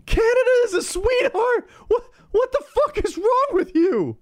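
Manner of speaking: very high, tearful mock screaming; hammed-up hysterical outrage. America defending Canada from ISIS, hysterical mock fury. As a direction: shriek in over-the-top distress.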